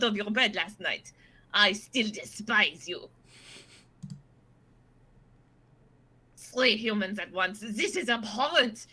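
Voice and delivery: put-on haughty, theatrical French-accented voice; disdainful French cat. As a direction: declaim it like an offended French aristocrat cat.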